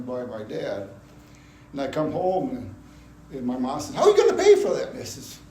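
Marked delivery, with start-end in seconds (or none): 3.9-4.9 high, loud imitation of an alarmed mother; incredulous mom. Mom challenges son who bought a car jobless, mock shriek. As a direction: squawk it like a mother in disbelief.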